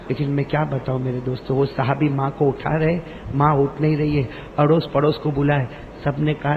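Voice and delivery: normal talking voice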